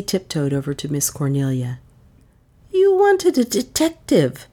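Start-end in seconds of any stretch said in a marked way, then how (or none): 2.7-4.5 high, slow ironic jibe. A narrator's reading. Maid needling the aunt about the detective, ironic. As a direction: draw it out with irony, pitched well up. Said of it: "in an ironic whisper".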